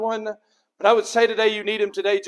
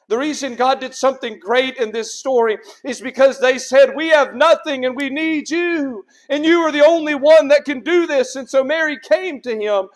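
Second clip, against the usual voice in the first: raised, ringing pulpit voice; forceful preaching; 5 semitones higher, 4 dB louder than usual. Real, not acted. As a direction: build to a loud, high declaration. Preaching builds to a peak.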